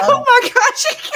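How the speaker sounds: high, loud laughing exclamation; laughing in disbelief; cracking up. Real, not acted. Laughing at tea order.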